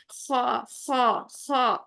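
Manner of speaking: slow scratchy kha demo. Shown as a demonstration. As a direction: scrape the throat on خا. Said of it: "خراش والی sound ہوتی ہے".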